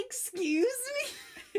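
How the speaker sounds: a stunned excuse me; indignant. Retold or imitated. Girl shocked by butt smack.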